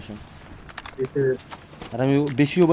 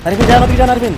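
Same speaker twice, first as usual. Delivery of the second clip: shouting; 6 semitones higher, 7 dB louder than usual. Real, not acted. Shouting teammate's name in fight.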